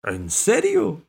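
high, loud astonished question; disbelieving exclamation. A narrator's reading. Cat unable to believe the offer, astonished. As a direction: leap up in pitch, incredulous. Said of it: "exclamó el gato aún sin creerlo".

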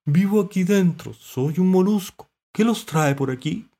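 high, loud, stretched character voice; friendly mollusc. A narrator's reading. Mollusc describing its shell to visitors, high and loud. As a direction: explain from under water, high-pitched.

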